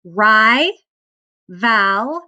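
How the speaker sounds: "Ri" and "val" said apart with a pause between; syllable by syllable. Shown as a demonstration. Teacher sounding out the syllables for students. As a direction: sound out "Ri" and "val" one at a time. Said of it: "let's sound out our syllables".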